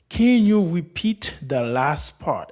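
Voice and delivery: very slow model question, falling end; slow repeat. Shown as a demonstration. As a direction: repeat it word by word for learners. Slower repeat for learners.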